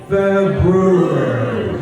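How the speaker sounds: slow lead-along "Feb-ROO-ary" for February; very slow February, sounding every syllable; February with R sounded. Shown as a demonstration. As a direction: draw out February for a group to join in, R clear. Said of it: "Say it with me".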